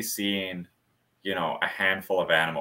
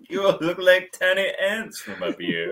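snooty giraffe voice; 10 semitones higher than usual. Acted. Giraffe talking down to humans.

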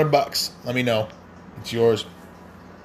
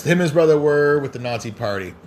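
ordinary talk

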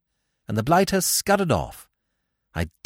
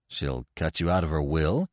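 much lower, unhurried theatre man; calm, low producer brushing it off; low George voice; 13 semitones lower, 6 dB quieter than usual. Acted. George on the phone brushing off Wooster, low and calm.

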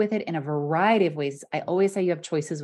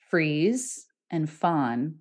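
very slow, each word given weight; slow emphasis; 2 semitones lower, much slower than usual. Real, not acted. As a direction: lay out freeze and fawn slowly. Naming the last two responses.

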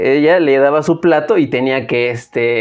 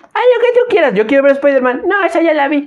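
put-on much higher old lady voice, dismissive; grandma refusing; 12 semitones higher than usual. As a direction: brush it off like a stubborn granny. Grandmother voiced, fake permission.

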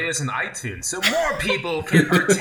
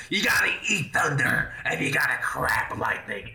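gravelly voice; 2 semitones lower than usual. Retold or imitated. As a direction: growl it like an old boxing trainer. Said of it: "had this real gravelly voice".

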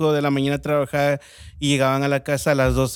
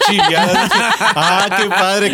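slow, higher and louder, laughing through it; embarrassed laughing delight; 5 semitones higher, 4 dB louder, slower than usual. Retold or imitated. Flustered boy answers a girl who likes his accent, laughing shyly. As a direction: gush shyly while laughing at the compliment.

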